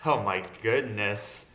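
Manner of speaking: ordinary talk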